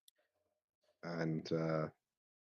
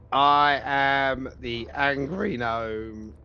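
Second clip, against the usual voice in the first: grand announcement; 7 semitones higher, 5 dB louder, much slower than usual. Acted. Player announces character on stepping out.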